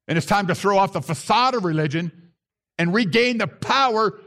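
a rising call to action; rally the congregation, line by line; preacher pushing toward a peak; building sermon appeal. Acted.

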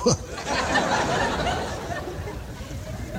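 airy giggle at his joke; amused chuckle; high breathy laugh. Real, not acted. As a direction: laugh it off lightly.

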